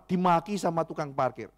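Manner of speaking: ordinary talk, nothing marked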